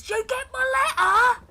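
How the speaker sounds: high Cockney yell; Walcott Savage Soul Byron. Acted. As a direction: yell the question down to another ship. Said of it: "he does yell down".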